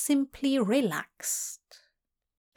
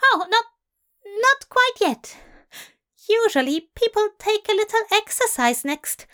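high, flustered fussy angel; flustered Aziraphale; 9 semitones higher, 3 dB louder than usual. Acted. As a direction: babble on, flustered and prim. Aziraphale voiced by narrator.

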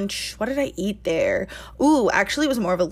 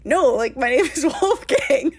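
higher, giggly mock insistence; laughing impression; 5 semitones higher than usual. Wolfgang insists on his name, quoted while laughing. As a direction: giggle through his correction.

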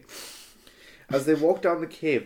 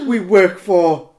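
dramatic confession; 3 semitones higher, 9 dB louder, slower than usual. Acted. Teletubby confessing.

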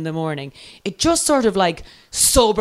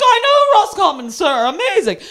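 very high, hammed-up enthusiasm; drunken gushing; 12 semitones higher than usual. Drunk passenger bonding with the driver, gushing. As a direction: gush as if thrilled by the news.

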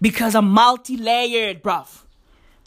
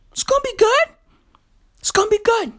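high sing-song promise; 12 semitones higher, faster than usual. Acted. Optimistic closing refrain.

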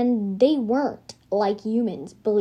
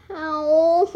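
whined; 5 semitones higher than usual. A narrator's reading. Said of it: "he whined".

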